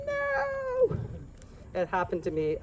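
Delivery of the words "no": high, drawn-out mock cry; mock wailed "no". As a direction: wail it high without full volume. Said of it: "you're gonna be like".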